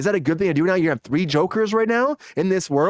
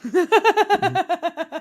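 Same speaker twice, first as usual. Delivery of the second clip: high, rapid chuckle; real burst of high giggling; amused laugh; 12 semitones higher than usual. Real, not acted. Host reacting to co-host's admission, amused laugh.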